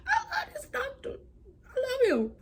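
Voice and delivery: very high-pitched adoring squeal; gushing adoration. Retold or imitated. Patient gushing over the doctor who checked up on her, high squeal. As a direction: gush in a tiny, high voice.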